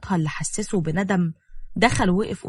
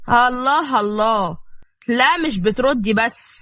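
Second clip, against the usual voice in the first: slow, louder, drawn-out scorn; slow mock; 4 semitones higher, 5 dB louder, slower than usual. A narrator's reading. Husband taunting his wife for answering back, slow mockery. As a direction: savor the taunt.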